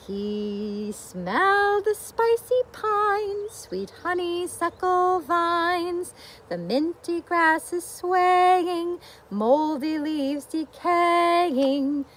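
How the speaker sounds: lively storybook reading; reading to children. A narrator's reading. Teacher reads the bear book to children, storybook voice. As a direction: read it out for a room of little ones.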